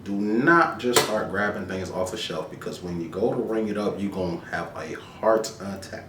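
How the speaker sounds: normal talking voice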